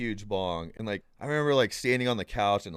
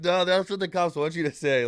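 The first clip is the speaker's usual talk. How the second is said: stoner comeback; 7 semitones higher, 6 dB louder than usual. Retold or imitated. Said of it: "doing the whole [name] and [name] skit".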